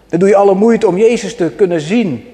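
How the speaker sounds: usual speaking voice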